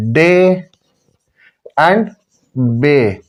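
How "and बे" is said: ordinary talk, nothing marked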